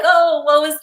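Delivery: sigh-worthy poor-me, lightly put on; feeling victim. Newlywed wife left alone, mock victim. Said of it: "more victim".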